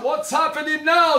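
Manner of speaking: high, panicked question; alarmed townsman. Acted. Townsman hearing the alarm, high panicked question. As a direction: ask like a townsman woken by the alarm.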